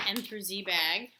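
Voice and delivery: ordinary talk, nothing marked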